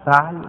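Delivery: "فعل" as fa'l, no vowel on ayn; "فعل" closed up into fa'l; second variant, fa'l. Shown as a demonstration. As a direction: say "فعل" with fatha, then sukun. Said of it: "يجوز فيه ثلاث لغات".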